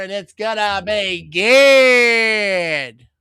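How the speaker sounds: loud, slow play-by-play build; drawn-out kick call. Real, not acted. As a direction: stretch the last word long and loud. Calls the extra point live.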